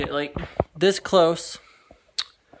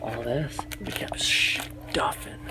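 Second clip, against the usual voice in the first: soft unvoiced whisper; 4 semitones lower, slower than usual. Real, not acted. Updating viewers from inside the hidden fort, whispering. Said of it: "I gotta be quiet".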